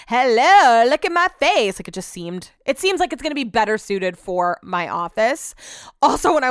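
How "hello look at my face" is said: loud and high, showing off; hammed-up look-at-me voice; mock proud hello. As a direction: announce your own face like a proud greeter. Mock showing off her magazine photo.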